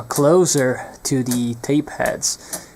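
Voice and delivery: ordinary talk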